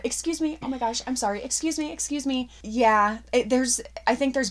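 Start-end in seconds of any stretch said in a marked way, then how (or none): 0.0-2.5 high, hurried sorry-excuse-me voice; mock flustered apologies, pitched up; flustered apologizing. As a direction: squeeze past crew, apologizing over and over. Acting squeezing past crew on set.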